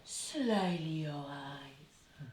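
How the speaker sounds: sly reading. Shown as a demonstration. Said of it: "What do you think of that word".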